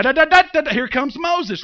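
loud, high da da da fanfare; heroic charge. Retold or imitated. Preacher acting out Moses rushing in, loud fanfare. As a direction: hum a hero's entrance.

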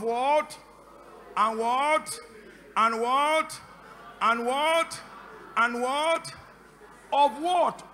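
loud rising "what?", punched out each time; preacher's insistent call for the answer; prompting call. Real, not acted. Prompting congregation to finish the verse.

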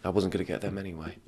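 very soft, embarrassed shopper backing off; sheepish mutter. Retold or imitated. Scolded shopper quietly putting the egg whites back. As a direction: mumble it like you got caught.

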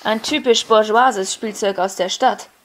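slow, loud condemning official; Lauban's verdict. A narrator's reading. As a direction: pronounce it like a party official's sentence. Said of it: "berichtete der Lauban".

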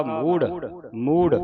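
ਮੂੜ pronounced heavy, with the aspirated ੜ; loud ਮੂੜ, weight on the last consonant; heavy final sound. Shown as a demonstration. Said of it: "ਇਸ ਨੂੰ ਭਾਰਾ ਉਚਾਰਨ ਕਰਨਾ". (ਮੂੜ ਭਾਰਾ ਉਚਾਰਨ)

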